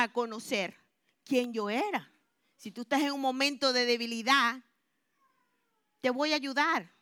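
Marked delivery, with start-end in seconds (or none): none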